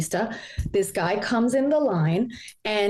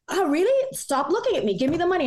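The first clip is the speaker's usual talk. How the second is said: higher, mock threatening robber; robber's threat; 9 semitones higher, faster than usual. Retelling the robber's demand, mock menace. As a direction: imitate the robber pushing her. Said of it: "And he's like".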